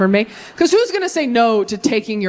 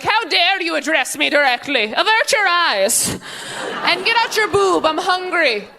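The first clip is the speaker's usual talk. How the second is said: put-on haughty, high-pitched demanding kid; monster child; 8 semitones higher than usual. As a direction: play an imperious little monster, high. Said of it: "they're gonna be monsters".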